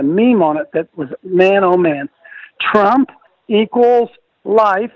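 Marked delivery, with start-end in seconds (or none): 2.6-4.9 slow, weighty reading of a slogan; emphatic slogan. Retold or imitated. Host reads a meme's slogan to Sheila, slow and emphatic. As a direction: spell it out slowly, a pause between the halves.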